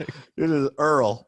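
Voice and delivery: slow Southern drawl; Southern accent. Retold or imitated. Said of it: "I like the accent that you did".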